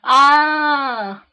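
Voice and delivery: high, long mock scream; held-back scream. Reader voicing the filly's cry with parents nearby, restrained scream. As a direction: scream loudly but only half in earnest. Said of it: "I'm not gonna scream very loud".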